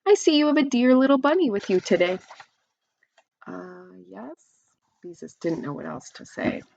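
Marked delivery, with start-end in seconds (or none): 0.0-2.2 cooing neighbor. A narrator's reading. Neighbor cooing over the bunny. 3.5-4.3 embarrassed mumble. A narrator's reading. Embarrassed girl at a loss.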